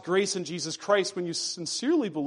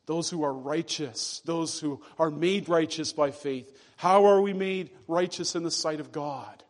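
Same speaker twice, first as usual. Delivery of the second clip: ordinary talk, nothing marked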